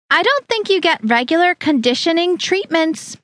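put-on high, condescending older woman; Harriet's voice. A narrator's reading. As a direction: talk down to her in a high prim tone.

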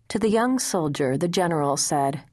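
ordinary delivery

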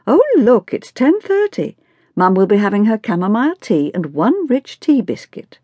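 high, mock-excited teasing; teasing family member. A narrator's reading. Family teases mother about her strict routine, mockingly.

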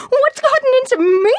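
high, indignant rising shout on gotten; angrier incredulous repeat. Acted.

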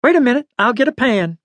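put-on high old lady voice; brisk old woman offering help. A narrator's reading. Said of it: "the old lady said".

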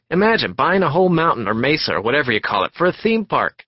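brash, loud, eager talker, words tumbling out; a pushy stranger who won't stop talking; loud chatty salesman. Acted. Passenger chatting up Warren on the monorail, loud and eager.

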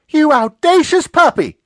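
loud, slow, scandalized scolding; indignant outburst. Acted. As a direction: bark it out like an outraged aunt. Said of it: "she jumped up and wiped it off".

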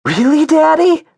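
put-on very high, loud little-kid squeal; amazed cry. A narrator's reading. Augie to his dad on hearing the news, amazed cry. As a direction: cry out in astonished delight. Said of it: "cried Augie, his mouth open".

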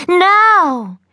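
high, loud, hammed-up wail; dramatic cry. A narrator's reading. Brother collapsing on the floor, hammy loud cry. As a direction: cry out like a man dying of heartache. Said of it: "he cried".

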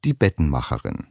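ordinary delivery

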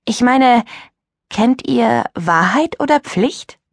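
put-on a girl slowly baiting the group; teasing. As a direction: draw it out with a sly hint. Julia suggests risky game.

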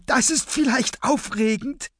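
very high, sing-out delight; gleeful excitement. Acted. Henchwoman delights in the standoff, high and gleeful. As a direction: thrill at it, pitched far up. Razzia claps, thrilled by standoff.

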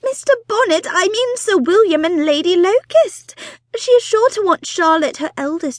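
high, loud, breathless society wife; fussy excited mother. Acted. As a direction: gush it out like an eager matchmaking mother. Mrs Bonnet correcting, exasperated.

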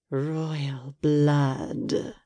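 an eerie presence savoring the words; sinister voice. A narrator's reading. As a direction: breathe it like a dark spirit. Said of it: "A sinister voice entered the room".

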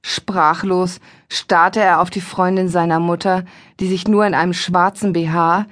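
ordinary delivery, nothing marked

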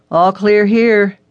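a detective calling the all-clear. Acted. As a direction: call it out slowly so she hears. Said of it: "he called out".

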